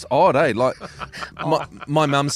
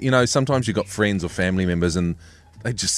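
ordinary delivery

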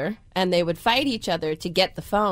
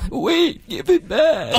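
higher, put-on protest; two-character squabble; 5 semitones higher, slower than usual. Alter ego fighting mom for the phone, high mock protest. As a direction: demand it in a character voice. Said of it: "she's fighting with herself".

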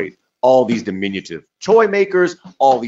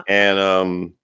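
ordinary delivery

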